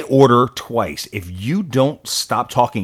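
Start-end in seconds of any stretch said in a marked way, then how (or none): 0.0-0.5 loud imitated Speaker's call; short, raised-voice mock command; call to order. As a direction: call the House to order, louder. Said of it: "He'll say".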